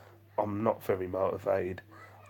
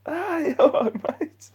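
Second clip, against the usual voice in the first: startled greeting; 9 dB louder, slower than usual. Retold or imitated. His startled reply to stranger.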